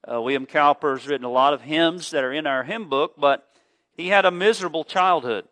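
ordinary talk, nothing marked

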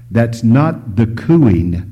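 ordinary talk

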